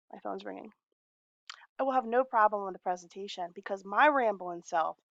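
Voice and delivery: ordinary delivery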